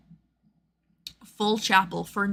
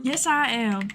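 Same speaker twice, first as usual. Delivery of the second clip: higher, playful Southern drawl; fake Southern accent; 4 semitones higher, slower than usual. Streamer answering as her VTuber character, fake Southern accent. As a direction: put on a mock Southern voice. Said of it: "I would do the accent".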